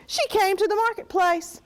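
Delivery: plain reading voice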